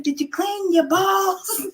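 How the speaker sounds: mom yelling. Retold or imitated. Mom yelling to her son in the shower, high and slow. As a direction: stretch it out calling down the hall. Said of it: "what I would have to yell".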